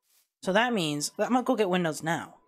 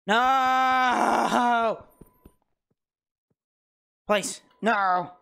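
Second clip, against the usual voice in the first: panicked no; 5 semitones higher, 4 dB louder, much slower than usual. Real, not acted. Mob attacks again.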